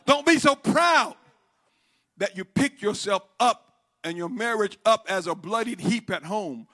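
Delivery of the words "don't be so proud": slow, raised preacher's cry; forceful exhortation. Real, not acted. Preacher warning those who hide their struggle, forceful exhortation. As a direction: cry it out to the congregation.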